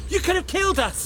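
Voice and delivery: high-pitched, alarmed telling-off; angry scolding. Acted. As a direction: shout in outrage after a near miss. Scolding the reckless young driver.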